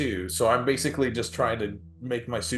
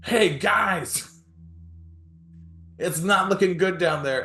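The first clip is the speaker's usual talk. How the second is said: high, loud call to the group; 8 semitones higher, 4 dB louder than usual. Acted. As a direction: holler a greeting, then report trouble. Acted call to party on arrival.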